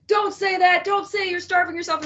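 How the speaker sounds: high, mock-scolding people. Podcaster mimicking critics of her post, high mock scold.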